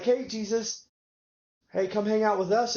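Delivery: rowdy bar crowd calling out. Retold or imitated. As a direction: wave him over like happy drinkers.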